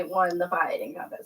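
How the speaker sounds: soft unvoiced whisper. Real, not acted. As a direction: whisper it aside to the camera. Whispered aside during pie contest.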